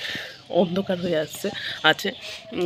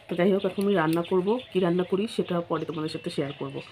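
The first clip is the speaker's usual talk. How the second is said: ordinary talk, nothing marked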